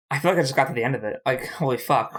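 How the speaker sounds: ordinary talk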